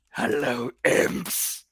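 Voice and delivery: low, husky creature voice; gnarled monster greeting. Acted. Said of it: "look at all of you and just goes".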